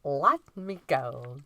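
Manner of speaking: slow, drawn-out go at an Irish accent. Shown as a demonstration. Co-host asked for her best Lucky Charms line, slow Irish accent. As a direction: give your best bad Irish accent, very slowly. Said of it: "In an Irish accent?".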